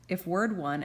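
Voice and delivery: ordinary delivery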